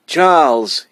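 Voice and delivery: high, loud call across a distance; shouting. A narrator's reading. Boy shouting to his mother up the hill. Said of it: "he shouted as he came up the hill".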